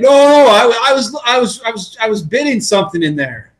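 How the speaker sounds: mock flustered excuse. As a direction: scramble for a cover story. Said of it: "I'll be like".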